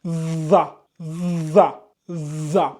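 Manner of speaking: "Za" with a long buzzing th, as in English this; tongue between the incisors, buzz into each "za"; drawn-out interdental z on "za"; tongue-between-teeth za. Shown as a demonstration. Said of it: "on met la langue entre".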